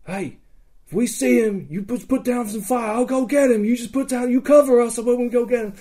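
high, driving battle instructions; retold urgent orders. Player briefs rescuers on covering fire, urgent orders.

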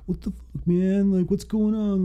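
high-pitched, mock angry outburst; retold pissed-off driver. Teen driver confronts the man who rear-ended him, mock pissed off. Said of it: "gets out of his car all pissed".

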